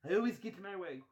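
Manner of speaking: smug villain line; Mister Burns. Retold or imitated. Boss gloating to Kermit, smug Burns voice. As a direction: gloat it slyly. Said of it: "Mister Burns".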